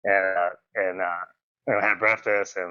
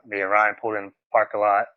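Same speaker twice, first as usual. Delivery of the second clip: ordinary talk, nothing marked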